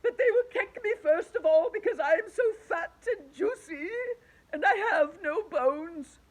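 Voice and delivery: high, loud crying wail. A narrator's reading. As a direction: sob out the fear. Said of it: "wailed the Earthworm".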